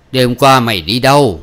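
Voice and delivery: loud, accusing question; mildly acted cross father; stern father. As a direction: demand an answer from the daughter. Father interrogates his daughter.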